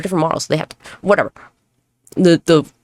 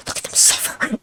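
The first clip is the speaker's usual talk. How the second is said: softer, breathy evil glee; gleeful whisper; 4 semitones higher, 5 dB quieter than usual. Retold or imitated. Couple watching survival show suffering, wicked whisper. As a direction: savour their misery under your breath.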